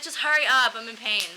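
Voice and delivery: urgent impatience. Retold or imitated. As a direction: push it out, impatient and hurting. In pain, hurrying the doctor.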